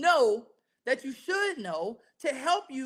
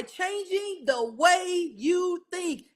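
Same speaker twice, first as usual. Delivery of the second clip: "the way you think" drawn out very slowly, landing on "think"; slow right down to weight "think"; stress on think; 4 semitones higher, much slower than usual. Shown as a demonstration. Preacher underlining the verse for the congregation, stress on think. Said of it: "Emphasis on the word think".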